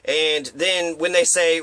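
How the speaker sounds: ordinary talk, nothing marked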